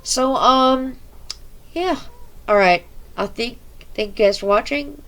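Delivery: energetic YouTube sign-off; loud outro. Acted. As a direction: hype up the outro.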